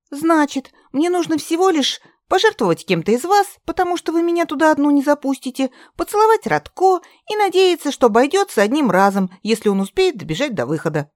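высоко и быстро, возмущённо; выпали план в негодовании; взволнованно, с возмущением. Acted. Катя возмущённо перечисляет план. (возмущённо и взволнованно)

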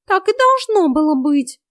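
high, loud retort; high-pitched, loud cat voice; snappish cat. A narrator's reading. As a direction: snap back as a defensive cat. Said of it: "огрызнулся кот".

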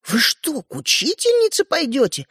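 high-pitched, astonished question; amazed. A narrator's reading. Sister hearing they may visit the teacher, amazed. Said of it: "изумилась Ариша".

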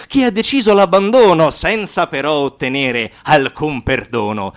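ordinary delivery

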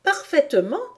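put-on high-pitched confident little girl; Alice assurée. A narrator's reading. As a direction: answer proudly as young Alice. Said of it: "répondit Alice". (petite fille assurée, voix aiguë)

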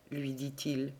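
ordinary delivery

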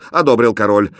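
usual speaking voice

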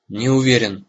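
ordinary delivery

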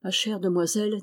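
ordinary delivery, nothing marked

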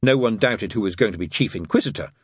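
plain reading voice

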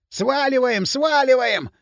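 raspy shout, pitched high; hoarse yell. A narrator's reading. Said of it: "хрипло орал Бивень". (хриплый крик)

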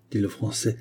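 usual speaking voice

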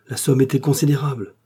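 ordinary delivery, nothing marked

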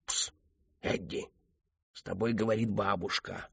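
soft, hushed summons; whispering grandmother. A narrator's reading. Grandmother's voice secretly calling Eddie, whispering. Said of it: "прошептал женский голос".

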